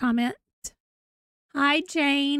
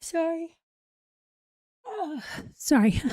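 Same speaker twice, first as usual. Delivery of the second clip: yawning through the words; 5 semitones higher than usual. Real, not acted. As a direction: say it mid-yawn. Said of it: "I'm also yawning".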